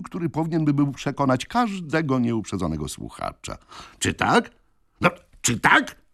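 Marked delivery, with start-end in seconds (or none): 4.0-6.0 rising, slightly higher probing question; lightly voiced young man. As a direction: press the crowd, a hint of swagger. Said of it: "zapytał młody człowiek". (lekko zagrany młodzieniec, pytający z naciskiem)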